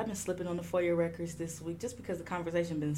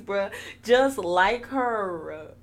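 ordinary talk, nothing marked

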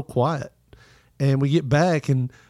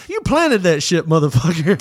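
mock angry outburst; 3 semitones higher, 4 dB louder than usual. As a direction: fling the accusation half-jokingly. Retold outburst at accuser.

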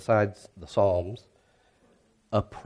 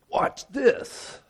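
a sudden high, breathy whisper of a menacing God; mock God's threat. Pastor imagines God answering a complaint, high mock whisper. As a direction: tease it as a playful divine threat. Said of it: "is He not gonna say".

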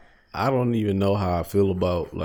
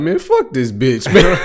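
mock grumble; 8 semitones higher, 7 dB louder, slower than usual.

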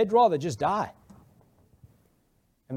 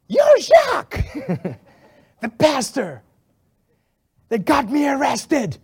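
very high, loud accusation; accusing shout; 9 semitones higher, 8 dB louder, slower than usual. Retold or imitated. Woman recognizing the pastor who got her arrested, loud accusing shout. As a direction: shout it at him in the street.

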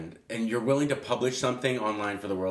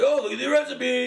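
mocking voice; 13 semitones higher, 5 dB louder than usual. Mocking recipe posters.

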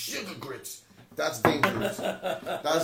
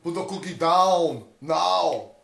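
Schwarzenegger impression; 3 semitones higher, 7 dB louder than usual. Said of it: "Arnold Schwarzenegger".

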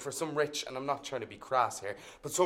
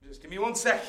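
ordinary delivery

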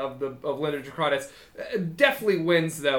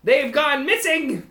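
high, frantic list climbing higher; wild-eyed kobold; 9 semitones higher, 3 dB louder than usual. Acted. Kobold reveals the missing items, high wild panic. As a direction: build in panic as a squeaky kobold. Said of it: "looks at you with wild eyes".

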